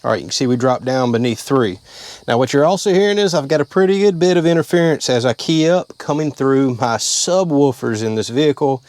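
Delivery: ordinary talk, nothing marked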